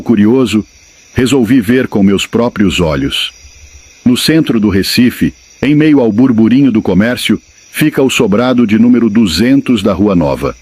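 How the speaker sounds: ordinary delivery, nothing marked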